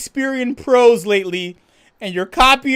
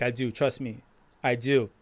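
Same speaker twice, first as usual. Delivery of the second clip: quiet sincere insistence; 8 semitones lower, 7 dB quieter than usual. Retold or imitated. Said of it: "I'm like".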